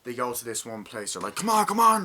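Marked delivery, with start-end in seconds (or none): 1.4-2.1 battlefield yell. Retold or imitated. Retold soldiers storming a battleground.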